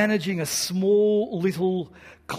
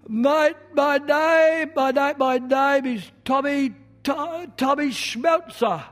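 high, halting, stammering voice, slow and straining; nervous stammering speech; 8 semitones higher, 5 dB louder, slower than usual. Retold or imitated. A shy man stammering his thanks to the auditorium, high and slow. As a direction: push each hello out against a stutter, voice pitched high.

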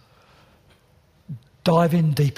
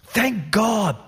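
impassioned preaching; 5 semitones higher than usual. Acted. Rising passionate preaching climax.

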